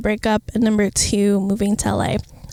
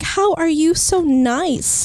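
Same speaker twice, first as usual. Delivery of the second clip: amazed; 7 semitones higher, much slower than usual. Retold or imitated. Amazed question to friend.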